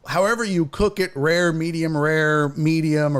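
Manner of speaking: ordinary talk, nothing marked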